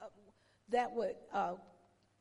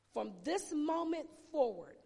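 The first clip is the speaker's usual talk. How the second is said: usual speaking voice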